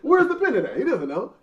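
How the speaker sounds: high, loud, through laughter; laughing, calling out the rival; laughing outrage. Real, not acted. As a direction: crack up while demanding it.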